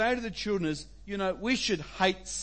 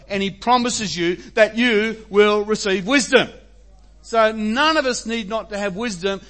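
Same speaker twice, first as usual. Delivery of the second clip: full-force preaching; 4 semitones higher, 9 dB louder than usual. Acted. Pastor urging the congregation to seek wisdom, loud and emphatic. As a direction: preach it out to the whole room with conviction.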